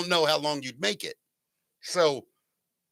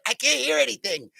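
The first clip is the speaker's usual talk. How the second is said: higher, squeaky cartoon voice; helium voice; 8 semitones higher than usual. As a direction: imitate a helium-pitched squeak. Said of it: "it sounded like helium".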